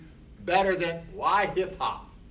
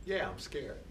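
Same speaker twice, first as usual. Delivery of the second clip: late-night admission; 9 dB quieter than usual. Retold or imitated. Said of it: "A voice really late at night says".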